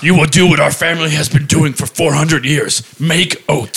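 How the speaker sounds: weighty patriarch invoking family tradition; mock stern father. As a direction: lecture your child as the oat-farm dad. Stern father in improvised scene.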